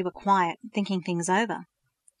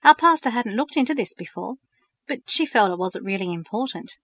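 usual speaking voice

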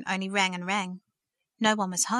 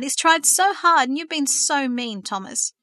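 loud, high and slow at first, then settling; indignant reproach fading at the name; angry outburst; 5 semitones higher, 7 dB louder, slower than usual. A narrator's reading. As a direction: scold him in a loud rush of feeling. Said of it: "Verity burst out".

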